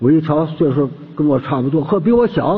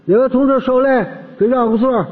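imitated bus conductor's higher-pitched call; 9 semitones higher than usual. Conductor asking passengers to give up a seat, imitated call. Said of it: "别等售票员喊".

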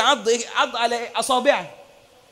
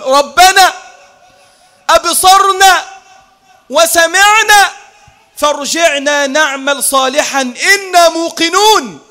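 loud, high pleading cry; crying out in regret; 5 semitones higher, 5 dB louder, slower than usual. Acted. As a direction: cry out to God, begging to return. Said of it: "يصرخون بأعلى أصواتهم ويقولون".

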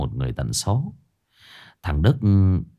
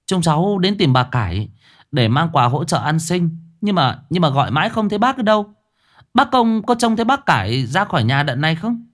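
high, carrying call up to a balcony; calling up loudly; 9 semitones higher than usual. A narrator's reading. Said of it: "Cô Liên ngửa cổ nói to". (cô giáo nói to, giọng cao, gọi lên ban công)

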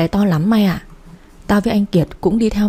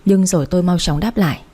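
usual speaking voice

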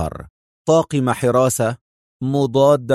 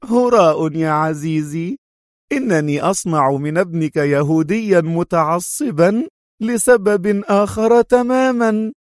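high, drawn-out dismissive scorn; mocking; 6 semitones higher than usual. A narrator's reading. Sonia hints at another reason, mocking. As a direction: dismiss him slowly, pitch climbing. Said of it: "ضحكة ساخرة قوية مضيفة".

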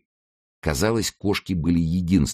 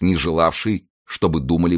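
usual speaking voice

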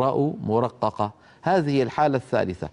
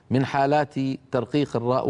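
ordinary talk, nothing marked

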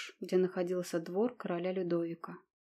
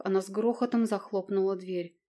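plain reading voice

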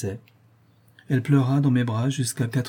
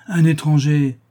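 shocked exclamation; 4 semitones higher, 6 dB louder than usual. A narrator's reading. Countess shocked at the idea.

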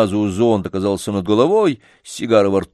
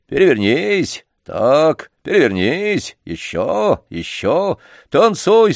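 put-on stern old trainer, high and loud; booming circus ringmaster; 5 semitones higher, 4 dB louder, slower than usual. A narrator's reading. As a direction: command the poodle with a menacing raised voice. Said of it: "грозно возвысил голос Ладышкин".

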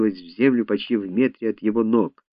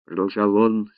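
ordinary delivery, nothing marked

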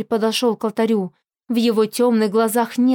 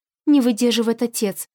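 usual speaking voice

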